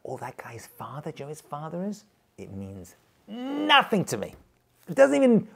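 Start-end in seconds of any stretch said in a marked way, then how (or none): 0.0-2.0 mock awed whisper. Rabbi mimicking people impressed by a prestigious family, hushed whisper. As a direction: imitate hushed, impressed gossip.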